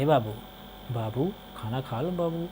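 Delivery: soft, coaxing mother's voice. Retold or imitated. Mother coaxing her child to eat, soft and coaxing. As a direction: softly coax a child to eat, like mum. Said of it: "mummy कहती".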